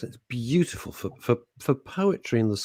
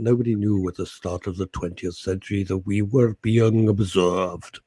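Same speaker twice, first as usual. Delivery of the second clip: lower, measured actor's narration; Richard Burton; 4 semitones lower, 4 dB quieter than usual. Acted. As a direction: imitate a solemn Welsh actor narrating. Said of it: "oh yeah, Richard Burton".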